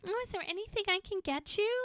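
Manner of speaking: high, quick, put-on sweet voice; sugary mock offer. Ex hovering over Jack offering help, high and sweet. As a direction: play her as overly sweet and eager.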